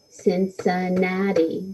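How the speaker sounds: "Cincinnati" slowly split into four even beats; syllable by syllable. Shown as a demonstration. Teacher counts four syllables for pupils, "Cincinnati" slow and separated. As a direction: clap out "Cincinnati" one syllable at a time. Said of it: "that would have four syllables".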